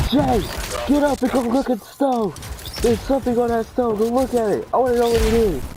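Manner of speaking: high, commanding mom; high bossy mom ordering him up. Retold or imitated. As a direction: mom hammed-up, demanding. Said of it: "My mom, she was like".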